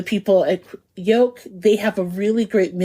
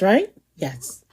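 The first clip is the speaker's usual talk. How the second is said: ordinary talk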